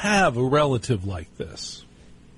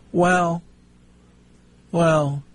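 put-on higher, hesitant old-aunt voice; critical great-aunt; 5 semitones higher than usual. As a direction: hold each "well", then deliver the complaint. Aunt's grudging verdict on turkey.

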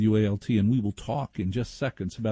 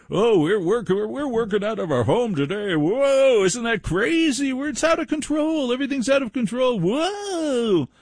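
much higher, gushing mock amazement; mocking home workers; 11 semitones higher than usual. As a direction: parody clueless people thrilled by working at home. Mocking people working from home.

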